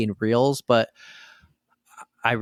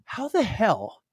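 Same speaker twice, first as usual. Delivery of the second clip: indignant disbelief; 8 semitones higher, slower than usual. Retold or imitated. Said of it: "And I'm like".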